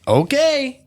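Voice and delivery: high mock agreeing dad; imitating dad. Dad agreeing to the gym promise, high mock okay. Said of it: "And then he said".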